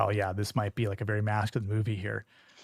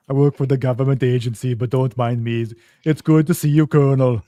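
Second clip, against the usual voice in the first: put-on loud Arnold-style Austrian accent; 10 dB louder than usual. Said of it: "don't mind my deep, strong Austrian accent".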